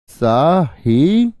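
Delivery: Sahi split into long SAA and HEE; syllable by syllable. Shown as a demonstration. Teacher asking students to look carefully, syllable by syllable. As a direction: stretch Sahi very slowly in two parts. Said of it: "देख लो ध्यान से".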